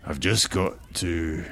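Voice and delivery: halting, understated emotion; slow down and falter, unable to finish; hesitant, lightly choked-up farewell; words catching in throat. A narrator's reading. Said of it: "the words caught in his throat".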